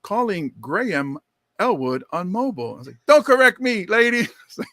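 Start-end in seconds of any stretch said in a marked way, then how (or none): 0.0-2.7 measured assistant, "Graham" as GRAY-um; car computer voice. Retold or imitated. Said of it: "then she comes on". 3.1-4.3 loud, raised comic scolding; mock indignation. Mock scolding the car computer.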